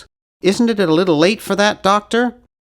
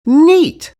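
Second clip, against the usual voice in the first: delighted; 10 semitones higher, 7 dB louder than usual. Acted. Delighted at a found item.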